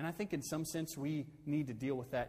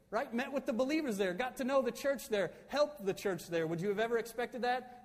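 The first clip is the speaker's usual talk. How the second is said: much higher and louder, driving hard at the congregation; full-force preaching; 9 semitones higher, 7 dB louder than usual. Acted. Pastor hammering home Paul's past to his church, full-force preaching. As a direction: preach it out at the top of your voice.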